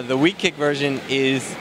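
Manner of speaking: normal talking voice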